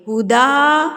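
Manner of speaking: ہدا with its vowel held briefly; one-count madd. Shown as a demonstration. Teacher shows the one-count stretch on ہدا. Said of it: "ایک حرکت کے برابر کھینچیں گے".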